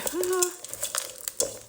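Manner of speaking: short high laugh, out of breath; a winded little laugh; breathy high laugh. Real, not acted.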